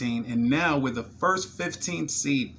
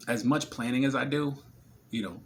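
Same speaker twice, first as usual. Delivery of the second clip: ordinary delivery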